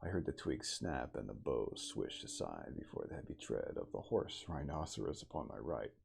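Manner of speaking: plain reading voice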